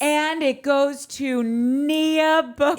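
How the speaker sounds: slow, loud crowning announcement; pageant announcer. Retold or imitated. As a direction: draw out the winner's name like an emcee. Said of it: "they're like".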